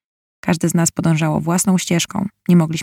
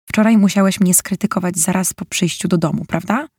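ordinary delivery

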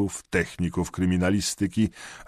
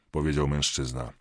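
ordinary delivery, nothing marked

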